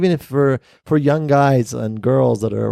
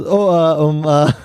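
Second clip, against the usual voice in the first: caught off guard; 6 semitones higher, 5 dB louder, slower than usual. Retold or imitated. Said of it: "he says".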